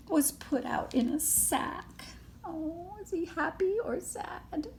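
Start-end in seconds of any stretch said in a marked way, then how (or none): none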